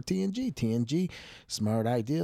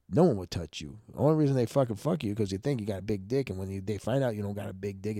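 usual speaking voice

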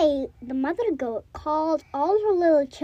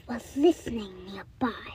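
whisper; 5 semitones lower, 6 dB quieter than usual. A narrator's reading. Sneaky wolf introduced.

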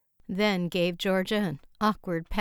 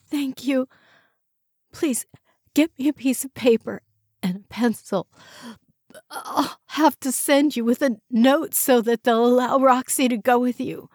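ill woman; 6 semitones higher, 3 dB louder than usual. A narrator's reading. Joyce voiced, ill.

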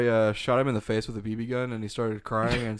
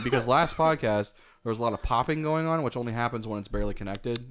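ordinary talk

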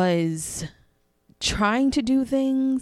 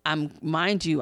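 usual speaking voice

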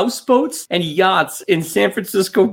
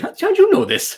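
mock surprise; 14 semitones higher than usual. Real, not acted. Co-host knew the detail.

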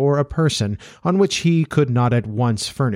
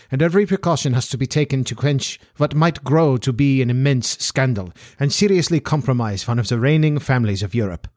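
put-on formal Central European-accented nobleman; accented aristocrat stating his terms; Bohemian Count; 2 semitones higher than usual. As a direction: play a grave, formal foreign Count. Voices the Count as a character.

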